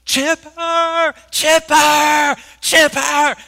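high, very loud fan hollers; mimicking a fan screaming from the stands; imitated repeated yelling. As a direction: yell it over and over to get the player's attention. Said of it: "he just yells".

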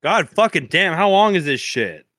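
high, exasperated swearing; exasperated outburst. Real, not acted. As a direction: blurt it out, fed up. Exasperated at the long list.

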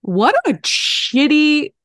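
high, slow, exasperated "shitty"; high drawn-out exasperation. Real, not acted. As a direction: let it soar in disgust. Cursing the year.

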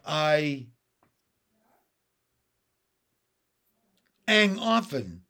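halting delivery with long pauses between phrases; hesitant, effortful frog speech with dropped consonants; slow, slight tremble. A narrator's reading. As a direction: say it slowly, trembling a little, stopping after "I". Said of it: "spoke slowly with a slight tremble".

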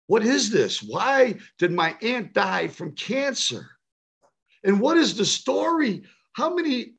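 put-on pleading, pitched high like a little boy near tears; mildly imitated tearful child asking why people die; child crying to his dad. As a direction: ask your father through rising tears, slowing down. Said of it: "I ran to my father crying".

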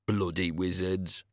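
slow, sour aside; weary, slowed resentment; resentful grumble. Acted. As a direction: grumble it under the imitation. Ernie drops back, resentful.

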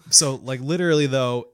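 ordinary talk, nothing marked

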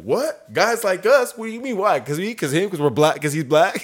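high, rising, incredulous questions; acted indignant disbelief. Coworker challenging the husband's remark, high indignant disbelief.

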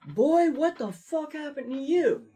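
high, loud, slow startled coworker voice; coworker's booming shock. Retold or imitated. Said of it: "he's like".

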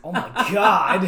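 loud, drawn-out laughing exclamation; cracking up. Real, not acted. Listener reacting to the professor story, cracking up. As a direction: laugh through it, loudly.

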